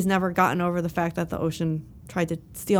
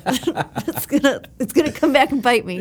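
giggling, higher and louder; laughing, holding back cough; 6 semitones higher, 5 dB louder than usual. Real, not acted. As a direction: laugh while stifling a cough. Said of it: "I'm trying to be really polite".

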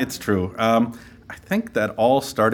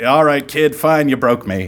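loud, raised grudging give-in; mock surrender; 4 semitones higher, 8 dB louder than usual. Guest giving in to little Timmy, loud mock surrender. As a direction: cave loudly with playful exasperation.

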